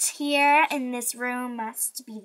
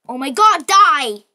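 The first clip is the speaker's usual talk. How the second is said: louder, yelling at the creepy toy; disgusted shout; 2 semitones higher, 11 dB louder, slower than usual. Real, not acted. Girl yelling at the creepy toy in disgust. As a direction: blurt it out in shock.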